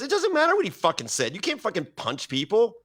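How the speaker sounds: high, heated telling-off; imitated angry yell. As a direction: snap at her like an outraged host. Joe Rogan yelling at the wife.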